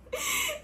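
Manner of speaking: short high-pitched laugh. Real, not acted. Interviewer thanking the guest for sharing, amused laugh. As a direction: let out a quick, high giggle.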